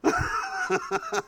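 a sudden high giggle; high laugh. Real, not acted. Preacher laughing at his own stubborn childhood, high giggle. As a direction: break into a high, soft laugh.

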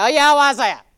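loud mock husband, dry and doubtful; husband's dry reply. Wife imitating husband's doubtful reply. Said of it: "That's how he says".

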